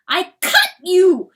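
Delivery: high, very loud shout; frantic cry. A narrator's reading. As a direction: yell it in frustrated anguish. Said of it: "cries Chanticleer".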